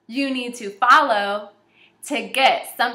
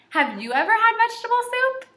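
much higher, quick question; playful excited question; 12 semitones higher, faster than usual. Real, not acted. Teacher asking scholars about soup, excited and high.

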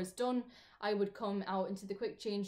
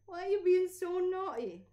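higher, louder mock scolding of a puppy; pet talk; 10 semitones higher, 6 dB louder than usual. Real, not acted. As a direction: scold the dog with affection. Scolding the dog fondly.

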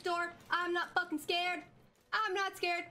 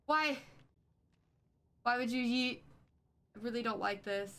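ordinary talk, nothing marked

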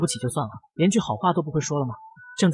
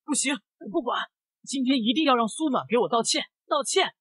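shrewish yell; 8 semitones higher, 3 dB quieter than usual. A narrator's reading. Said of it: "像个泼妇似的吼道".

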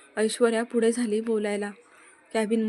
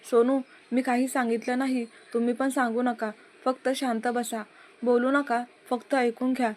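usual speaking voice

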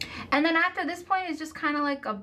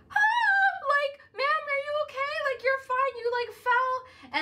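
much higher, frightened little yelp into pleading; mild panicked scream. Retold or imitated. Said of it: "I start screaming, like, not like a lot".

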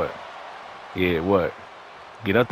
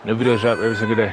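fast outro; 2 semitones higher, 3 dB louder, much faster than usual. Real, not acted.

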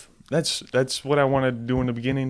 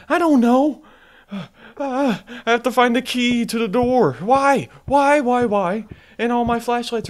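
high-pitched, loud distressed complaint; exasperated whining; 10 semitones higher, 6 dB louder than usual. Real, not acted. Player stuck at a locked door, exasperated wail. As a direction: wail in frustration.

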